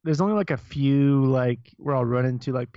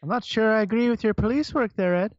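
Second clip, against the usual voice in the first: higher-pitched mock Fargo accent; 6 semitones higher than usual. Quoting the movie's police work line, Fargo accent. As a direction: quote it in a Midwestern Fargo accent. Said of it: "Fargo accents in that movie".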